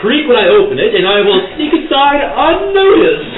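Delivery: hammed-up grand narration; mock dramatic. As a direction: announce the sneak pompously. Mock-grand declaration of sneaking.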